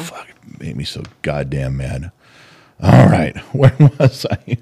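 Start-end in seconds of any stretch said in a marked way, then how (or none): 0.0-2.1 low, soft angry grumble under the breath; muttered anger. Real, not acted. Husband grumbling quietly after ranting about a video. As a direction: grumble it to yourself, still seething. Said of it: "I'm getting angry".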